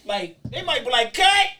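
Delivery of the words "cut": director yelling. Retold or imitated. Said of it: "Now he yelling".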